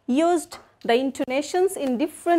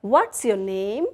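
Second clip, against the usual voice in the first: ordinary delivery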